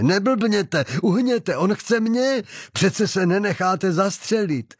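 high-pitched agitated urging; urgent plea. Acted. Targeted man to the two shielding him, urgent alarmed plea.

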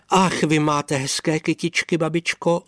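put-on excited young princess. A narrator's reading. Růženka admires the old woman's flowers, lightly voiced eager girl. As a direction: exclaim like a delighted young girl.

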